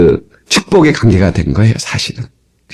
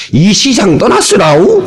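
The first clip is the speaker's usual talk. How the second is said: high, drawn-out mock Jeolla dialect; 6 semitones higher, slower than usual. Shown as a demonstration. Preacher shows how a death is announced in Jeolla dialect. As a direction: stretch it out in imitated Jeolla dialect. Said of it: "전라도 말은".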